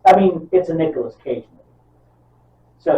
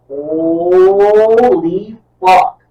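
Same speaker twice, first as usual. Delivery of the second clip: hugely drawn-out, loud exclamation; stunned amazement; 6 semitones higher, 9 dB louder, much slower than usual. Retold or imitated. Reliving shock at the moving burning bag, stunned. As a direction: stretch the first word out, rising in awe.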